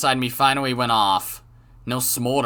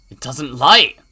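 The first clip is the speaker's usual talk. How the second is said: frustrated outburst; 8 dB louder, slower than usual. Acted. Frantic narration.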